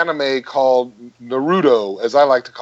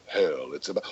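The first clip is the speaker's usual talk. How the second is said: Takis J. Finley voice; 11 semitones lower, 13 dB quieter than usual. Shown as a demonstration. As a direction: sink low and stretch it out. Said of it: "And then, you know".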